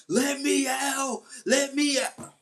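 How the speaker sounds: high, slow desperate yell of let me out; yelling inmates. Retold or imitated. Inmates yelling from their cells, a high, drawn-out desperate shout. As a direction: cry out like a prisoner begging to be freed. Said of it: "you hear people yelling".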